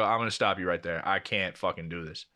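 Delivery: low, subdued retold refusal; fed up, voice dropped low; weary cut-off. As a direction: shut the argument down quietly. Retold cutting off of an argument.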